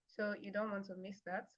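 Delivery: normal talking voice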